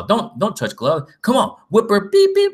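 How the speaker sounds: egging her on, rising to a high squeal on the beeps; excited mock quote. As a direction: mimic a fan yelling at the fight, climbing up high. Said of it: "she's all".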